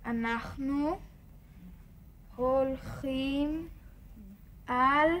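slow, word drawn out to teach. Acted. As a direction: spell it out for a learner. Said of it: "teaching grandma words".

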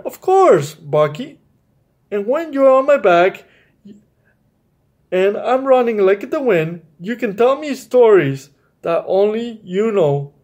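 lightly voiced rooster, high on the first words; a hint of a proud rooster answering a boy. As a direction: rooster voice, mostly the reader's own. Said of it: "And the rooster answered".

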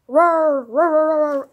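loud roaring monster. Pretend. Kid playing monster roars at friends. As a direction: roar it out like a beast attacking.

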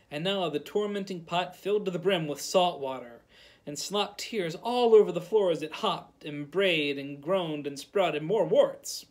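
usual speaking voice

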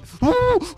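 a sudden high gasp of shock; startled gasp; sharp high gasp. Real, not acted. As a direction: gasp in surprise. Sudden surprise in fight.

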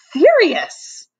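high, loud exasperated snap; snorted retort. A narrator's reading. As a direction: snap back, fed up. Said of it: "Stanford snorted".

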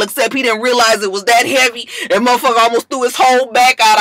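ordinary talk

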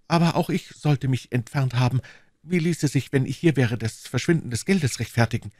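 put-on fretful young wife, voice lifted; worried young woman. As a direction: reason it through with growing worry.

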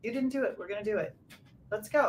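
calm, no-nonsense push to action; firm coach. Retold or imitated. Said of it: "Now I'm like".

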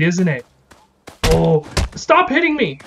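ordinary talk

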